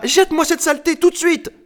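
very high, loud shriek; horrified screeching order. Acted. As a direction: screech the command in disgust. Terrified lady cuts him off.

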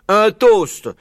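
high, loud and slow toast; Blaireau proclaiming. Acted. Blaireau raising a glass to his friend, proclaiming. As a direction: proclaim it grandly to a whole room. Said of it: "et s'écria".

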